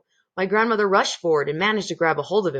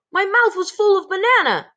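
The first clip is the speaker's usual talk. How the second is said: put-on much higher, louder childish voice; Bruno the greedy boy; 13 semitones higher, 6 dB louder than usual. A narrator's reading. Bruno answering the grandmother, high childish whine.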